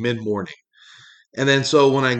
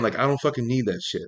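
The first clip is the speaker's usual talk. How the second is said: ordinary talk, nothing marked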